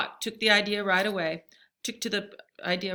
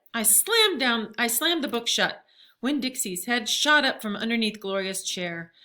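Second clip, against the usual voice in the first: ordinary delivery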